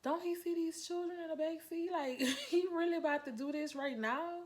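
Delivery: high, incredulous protest; can't believe he's doing this in front of the kids; outraged disbelief. Retold or imitated. Mother to police officer, indignant and disbelieving. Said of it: "I'm like".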